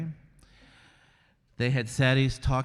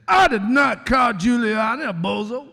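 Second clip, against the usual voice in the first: loud, much higher preacher-style denial; Al Sharpton impression; 12 semitones higher, 11 dB louder than usual. Roseanne mocking Sharpton's denial for the host, loud and high. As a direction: boom it out like a fired-up Sharpton. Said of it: "I heard Sharpton say".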